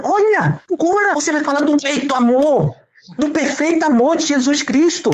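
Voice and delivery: high, urgent preacher build; climbing pulpit urgency, pitch way up; fervent preaching. Acted. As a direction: press the point home with rising fire. Rising, preaching at full force.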